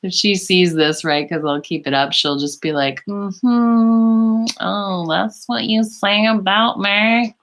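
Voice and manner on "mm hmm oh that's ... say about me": high, slow knowing mm-hmm; hammed-up knowing girlfriend; mock indignant friend. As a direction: tease like a friend who caught you. Imitating girlfriend catching her out.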